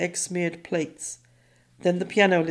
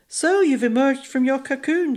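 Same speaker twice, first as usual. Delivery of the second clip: stepmother voice; 7 semitones higher, 4 dB louder than usual. A narrator's reading.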